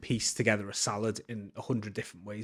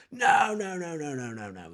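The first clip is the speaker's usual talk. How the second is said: emphatic refusal; 6 semitones higher, slower than usual. Retold or imitated. Chef dismissing the boss's recipe, insistent no's fading out. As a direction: shoot the idea down, then let it trail off. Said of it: "The chef goes".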